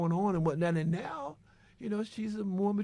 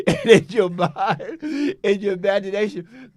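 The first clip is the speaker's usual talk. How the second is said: laughing; 3 semitones higher, 8 dB louder than usual. Real, not acted. Laughs while describing daydream.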